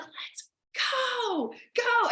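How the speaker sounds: high-pitched coach egging the team on; coach cheering. Retold or imitated. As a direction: hype up a player from the sidelines. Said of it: "I'm like the Kim at L S U basketball".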